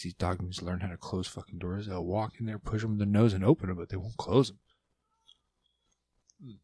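normal talking voice

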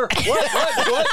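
high rising what; shocked. Acted. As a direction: squeal it in disbelief. Acting shocked confusion.

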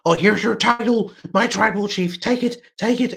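mock Heyman. Mocking Paul Heyman handing belt.

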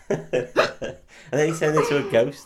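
usual speaking voice

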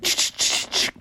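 rapid voiceless "ch" clicks; soft staccato shutter sound; camera shutter noises. Acted. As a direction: imitate a burst of snapshots with breath.

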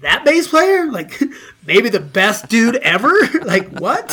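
high, loud disbelief with laughs; retold shock, laughing; incredulous. Retelling his own disbelief.

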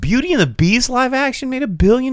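high, loud shocked question; scandalized at the number; outraged disbelief. Real, not acted.

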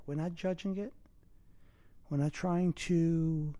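calm breathing guidance, unhurried; guided meditation. Acted.

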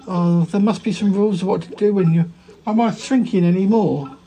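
ordinary talk, nothing marked

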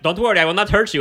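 hammed-up fake reassurance; mock soothing terrorist. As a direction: play the villain lying sweetly. Mimicking the movie terrorist.

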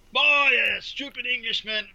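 loud, high mock jeer from the stands; jeering crowd. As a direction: fans jeering the English referee, loud and high. Angry fans jeering the referee.

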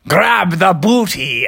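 slow, booming giant command; a lumbering giant grabbing the loot, slowly; giant's voice. Acted. Giant reaches through shop roof.

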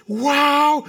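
high, loud drawn-out wow; hammed-up sarcastic delight; mock amazement. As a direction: exclaim with exaggerated wonder. Mock amazement at numbers called.

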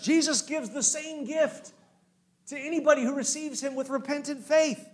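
high, loud triumphant preaching; full-force preaching. Acted. Pastor proclaiming resurrection to congregation, fervent. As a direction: proclaim it with fire.

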